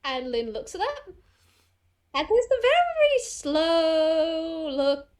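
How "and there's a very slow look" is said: very slow, stretched vowels; drawn-out narration. A narrator's reading. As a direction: draw out every word to match a slow gaze. Stretches words to show slow look.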